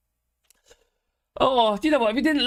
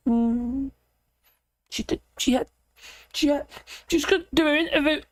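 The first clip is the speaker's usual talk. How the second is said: ordinary talk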